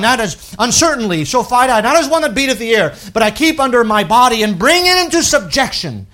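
loud, high, ringing scripture reading; preaching at full force. Acted. Preacher reading scripture to his church, loud and ringing. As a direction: proclaim the verses to the whole congregation.

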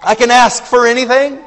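loud, high, forceful preaching; fiery preaching. Acted. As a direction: cry it out like a revival preacher. Preacher imagining Ahaz's prayer.

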